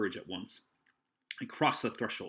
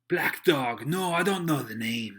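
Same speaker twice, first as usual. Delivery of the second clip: loud, raised musing; aloud; 5 semitones higher, 9 dB louder, slower than usual. A narrator's reading. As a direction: think out loud for all to hear. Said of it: "he ran on again aloud".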